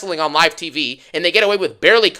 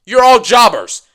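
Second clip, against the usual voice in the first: louder; 4 semitones higher, 6 dB louder than usual. Shown as a demonstration. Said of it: "repeats line louder".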